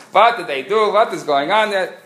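put-on old lady yelling from the camel; mock screaming.

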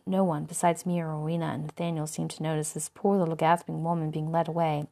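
ordinary delivery

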